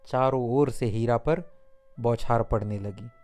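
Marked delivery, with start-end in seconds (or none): none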